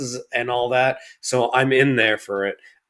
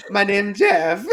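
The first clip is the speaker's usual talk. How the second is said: mock Gambit accent; 5 semitones higher, slower than usual. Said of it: "Channing Tatum's accent's a little offensively".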